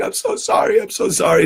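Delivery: high-pitched imitation of crying; mock tearful. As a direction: put on a tearful apology. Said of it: "he bursts into tears and he starts crying".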